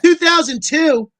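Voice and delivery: high, loud, slow disbelief; surprised. Real, not acted. Reacting to co-host's birth year, stunned. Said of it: "surprise at her birth year".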